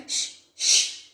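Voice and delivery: whispered frantic shh; breathy whispered shushing; hushing. Acted. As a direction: hush the king in fear. Scared soldier hushes king.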